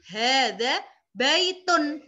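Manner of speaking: "hadza baitun" slow, every vowel marked clearly; Arabic model reading. Shown as a demonstration. Teacher showing students how to read with harakat, slow and clear. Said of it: "mengharokati kata seperti".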